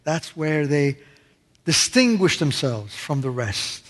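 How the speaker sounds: ordinary talk, nothing marked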